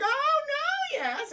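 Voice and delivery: high, slow, sneering villain falsetto. Retold or imitated. Streamer voicing the Diddler scolding a kid, high creepy falsetto.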